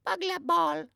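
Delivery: put-on very high lisping toddler "Burglar Ball"; a toddler mangling "Burglar Bill" into "Ball"; baby voice. A narrator's reading. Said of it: "says the baby".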